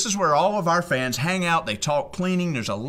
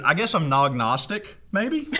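usual speaking voice

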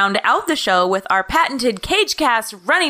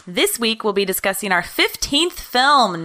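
ordinary talk

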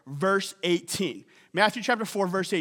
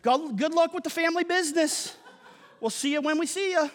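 higher-pitched pretend son breaking news to Dad; mock offhand son; 6 semitones higher than usual. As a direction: play a son cheerfully abandoning the family business. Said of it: "you go home and you say".

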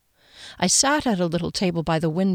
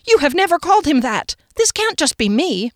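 panicked protest; 10 semitones higher, 5 dB louder than usual. Acted.

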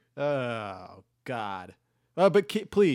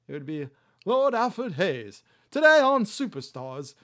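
high, loud announcer impression; hammed-up Lord Alfred Hayes; 7 semitones higher, 8 dB louder than usual. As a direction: announce it like a wrestling commentator. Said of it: "put Lord Alfred Hayes on the list".

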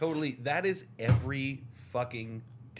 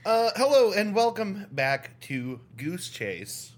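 announcer voice; 2 semitones higher, 6 dB louder than usual. Acted. Podcast intro announcer voice.

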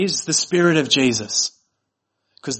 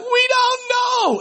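put-on very high, drawn-out American kid wail; mock American accent; slower than usual. Retelling the mother's story of crying kids, wailing American accent. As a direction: imitate crying children in a hammed-up American accent. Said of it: "in her American accent".

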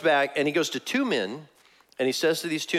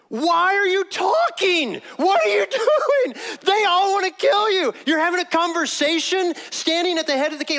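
high, loud, incredulous outburst; exasperated yelling; 5 dB louder than usual. Acted. Soldier to companion chatting among sleeping enemies, exasperated yelling. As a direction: yell in panicked disbelief at a chattering companion.

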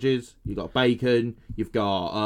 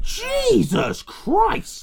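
high, slow shocked exclamation; much slower than usual. Real, not acted. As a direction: gasp it out in disbelief. Shocked at killing count.

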